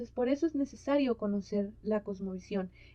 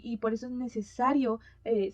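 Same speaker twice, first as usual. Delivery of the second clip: normal talking voice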